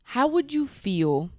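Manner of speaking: slow, overly careful imitation of Nick; mock careful Nick. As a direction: spell out each letter like a prim, cautious guy. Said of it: "Nick says".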